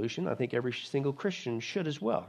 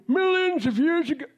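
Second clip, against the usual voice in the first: high, louder sarcastic aside; mock evolutionist insert; 11 semitones higher, 5 dB louder than usual. Preacher mocks evolution in a high, louder voice while reading Genesis. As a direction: slip in the rival's version mockingly.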